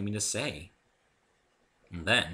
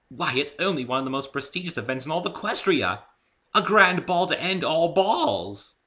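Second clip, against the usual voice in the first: grandiose; 8 semitones higher, 7 dB louder than usual. Acted. Rarity praising the Gala, grand and dramatic. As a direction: sweep into a dramatic flourish.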